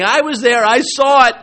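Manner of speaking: proud, ringing testimony; letting it rip. Acted. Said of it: "[name] is letting it rip here".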